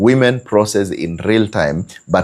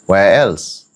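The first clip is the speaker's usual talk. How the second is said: normal talking voice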